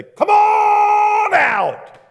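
long, slow, high bellow; booming slow shout; drawn-out shout. Acted. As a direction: stretch the call out as a huge yell. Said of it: "didn't just walk up to the grave and say".

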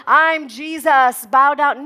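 loud, drawn-out mock proclamation; mock yelling. Showing how Jesus didn't have to act, mock yell. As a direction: boom it out slowly, overdoing the authority. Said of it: "He didn't have to scream".

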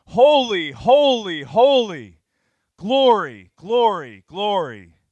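full-force high shout, each word falling; huge drawn-out acclamation, high and very loud; screaming angels. Acted. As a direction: scream it so the walls shake. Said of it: "they're screaming so the walls shake".

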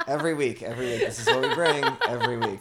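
laughing through the words. Real, not acted. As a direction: giggle it out. Joking while laughing.

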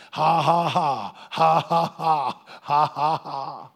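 loud, deliberate ha-ha-ha in bursts; imitated forced laughter. Preacher acting out Brother Hagin's answer to returning symptoms, forced laughing. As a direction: act out a defiant staged laugh. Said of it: "he said he went to laughing".

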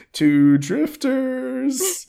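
very slow, high, stretched announcement; drawn out. Acted. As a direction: stretch every syllable out. Mock announcer read of title.

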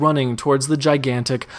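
usual speaking voice

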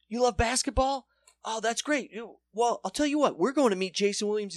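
high mock-enthusiastic welcome; gushing Globetrotters. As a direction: talk to him like an excited kid.

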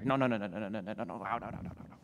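low, soft run of no's trailing away; dwindling dismissive mutter; fading refusal. Acted. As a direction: mumble no over and over into nothing.

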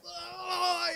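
high wailing mock groan; mock wail of the children; imitated kids' dismay. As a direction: groan like a roomful of caught kids. Kids reacting in dismay.

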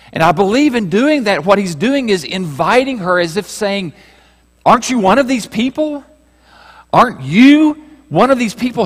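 high, loud, pleading sermon voice; building fervent appeal to the woman; preaching at full force. Acted. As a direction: press the invitation home from the pulpit.

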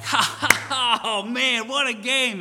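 high, loud laughing cheer, imitating a thrilled partner; excited laughing. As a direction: cackle it out like a delighted winner's friend. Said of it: "And he's all excited about".

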